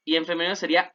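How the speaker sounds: usual speaking voice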